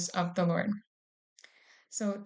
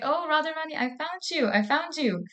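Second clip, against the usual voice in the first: mock joyful shout on finding her, pitched way up; excited call; 8 semitones higher than usual. Krishna finds Radharani and calls out in excitement, high and bright. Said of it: "started shouting".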